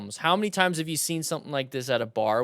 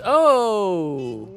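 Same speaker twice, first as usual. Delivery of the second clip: high, loud, drawn-out oh; startled reaction; 5 semitones higher, 5 dB louder than usual. Real, not acted. Host cut off mid-sentence by the clip, startled. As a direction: cry out as it goes wrong.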